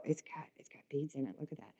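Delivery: hushed whisper; excited whisper. Real, not acted. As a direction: whisper it, voiceless and soft. Whispering about beads.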